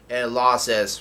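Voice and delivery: ordinary talk, nothing marked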